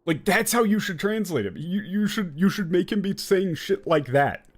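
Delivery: ordinary talk, nothing marked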